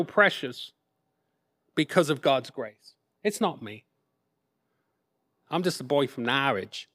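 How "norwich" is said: Norwich said as "NAR-idge", low and soft; Norwich local accent. Shown as a demonstration. Preacher shows the congregation his hometown, Norwich said "NAR-idge" in local accent. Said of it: "That's how they say that".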